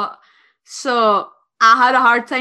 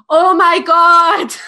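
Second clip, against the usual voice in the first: loud, drawn-out exclamation, higher pitch; shocked reaction; 4 semitones higher, 9 dB louder, slower than usual. Retold or imitated. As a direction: act out her big shocked outburst. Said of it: "her reaction when she goes".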